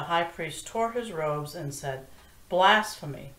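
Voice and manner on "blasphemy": a loud, indignant cry of Blasphemy; outraged cry. Acted. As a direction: denounce it, louder. High priest tears his robes.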